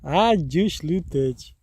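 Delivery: Аджушлютедж said slowly and clearly; slow clear demo. Shown as a demonstration. Helping viewers remember the farewell, slow and clear. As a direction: repeat Аджушлютедж at half speed for memorising. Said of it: "Вот это запомните".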